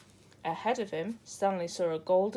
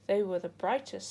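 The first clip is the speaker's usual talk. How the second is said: usual speaking voice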